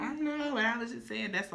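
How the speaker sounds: very high put-on voice, settling down; high mock protest. Host mimicking co-host's high protest, squeaky mock protest. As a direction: answer back in a squeaky pitch. Answers back in same high voice.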